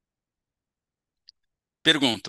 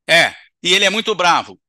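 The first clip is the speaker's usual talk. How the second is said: loud, high, heated complaint; angry spirit; 5 semitones higher, 9 dB louder than usual. Acted. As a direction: spit it out about the fierce boss.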